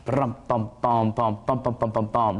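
imitated marching drum; "bum, bum" tapped out in steady march rhythm; vocal march beat. Shown as a demonstration. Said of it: "It's more of a march".

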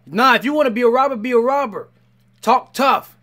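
loud, high, quick lecture; fired-up scolding. Real, not acted. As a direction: tell him off with gusto. Scolding the crying robber.

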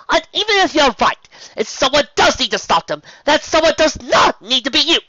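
high, loud arguing; heated insistence. A narrator's reading. Rarity insisting Spike stay out, heated.

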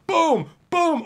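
loud mock punch noises; punch sounds. Mock beating a troll with punches. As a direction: sound out the hits.

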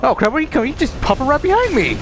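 startled yelp. Real, not acted. Boss teleports behind him.

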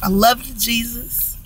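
praying through tears, voice fading to nearly nothing; real tearful thanks to Jesus; tearful prayer. Real, not acted. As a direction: thank God while welling up. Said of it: "I didn't mean to cry".

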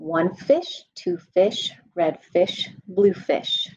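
lively sing-song read-aloud voice; animated bedtime story reading; reading to children. A narrator's reading. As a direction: read it bouncy for little kids.

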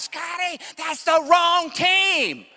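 a mother yelling across the stadium at her son; mom screaming from the stands. Retold or imitated. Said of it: "she's screaming out".

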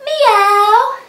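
high loud rising meow; a big cat meow. Shown as a demonstration. Teacher counting cat sit-ups with a loud high meow. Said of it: "cat sit-ups".

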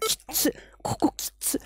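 a strained little whisper while struggling with the box; whispered under effort. Real, not acted. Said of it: "これ、きっつ".

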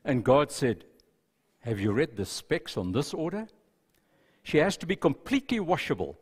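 soft, hushed storytelling read; story narration. Acted. Pastor reading a story to the congregation, softly told. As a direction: read the tale gently to the congregation.

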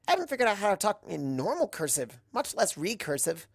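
high, fast and bubbly rambling; Pinkie Pie voice. Acted. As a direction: chatter on fast in a bright high pony voice.

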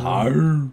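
growly cow noise, lightly done; a mild moo-ish arr; imitated cow moo. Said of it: "That's what they sound like".

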